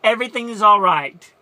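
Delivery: loud, bright insistence that all is well; over-loud brave front; forced reassurance. Retold or imitated. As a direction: push past the question, covering grief. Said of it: "this is what she says".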